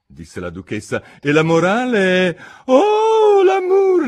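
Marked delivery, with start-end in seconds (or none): 1.2-3.4 much higher, much louder gushing cry; swooning duchess. A narrator's reading. Duchess declaims on love, swooning and loud. 3.4-4.1 "l'amour" sung high and loud, falling away; swooning l'amour. A narrator's reading. Duchess sighs "l'amour" high, falling.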